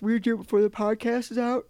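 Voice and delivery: words stretched through a yawn. Real, not acted. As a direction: talk while yawning. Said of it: "I'm yawning".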